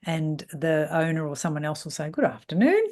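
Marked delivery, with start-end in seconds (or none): 2.1-2.8 pointedly sarcastic, pitched up; high, needling singsong; smart-ass greeting. Retold or imitated. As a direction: greet a latecomer with loud mock politeness. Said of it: "and I'm a smart ass".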